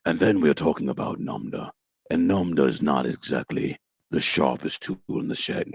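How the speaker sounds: low, slowed, powerful dim brute; Namda. Shown as a demonstration. Actor demonstrates the not-so-sharp fighter, low and powerful. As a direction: drop the voice deep and speak with power. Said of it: "his voice gets a little lower".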